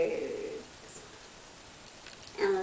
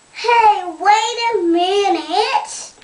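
calling out; 9 semitones higher, 7 dB louder, slower than usual. Acted. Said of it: "said all the friends".

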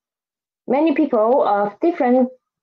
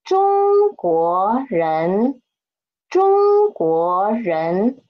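中国人 drawn out syllable by syllable, Zhōng-guó-rén; 慢速示范; slow demo; much slower than usual. Shown as a demonstration. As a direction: say 中国人 very slowly so students can copy. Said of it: "Read after me".

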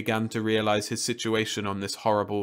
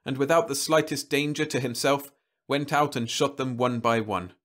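plain reading voice

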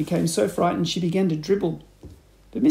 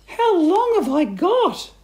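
slow, high, loud wail of dread; hammed-up despairing cry; 6 dB louder, slower than usual. A narrator's reading. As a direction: wail the question high as a panicked wife. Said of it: "cried Missus Twit".

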